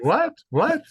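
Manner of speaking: high, loud puzzled What? What?; bewildered double-take; confused "what". Retold or imitated. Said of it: "people always say".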